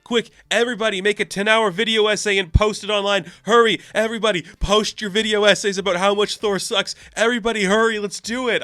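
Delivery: high-pitched, hammed-up villain rallying a crowd; mock-frantic, urgent call to arms; Loki. Loki urging everyone to cancel Thor, hammed-up urgency. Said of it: "And Loki's like".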